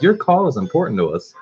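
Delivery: high, sugary automated voice; sweet recorded hold message. Retold or imitated. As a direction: mimic a syrupy phone recording. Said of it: "it's like".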